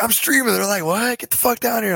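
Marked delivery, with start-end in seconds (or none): 0.0-0.5 high-pitched retold protest; urgent explaining. 0.8-1.9 higher-pitched, incredulous command; retold police order.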